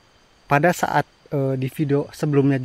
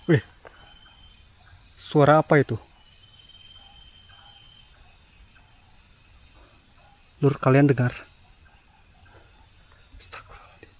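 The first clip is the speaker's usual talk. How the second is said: scared horror game streamer; 7 semitones higher, 7 dB louder, faster than usual. Real, not acted. Hears sound, sees glowing thing.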